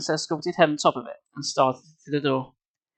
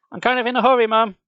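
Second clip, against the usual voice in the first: louder higher call; 8 semitones higher, 5 dB louder, faster than usual. Acted. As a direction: call across the house. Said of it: "Carly Beth called".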